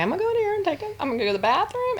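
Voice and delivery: a hint of a new mom ducking out; sneaking-off patient. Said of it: "voicing a patient hiding".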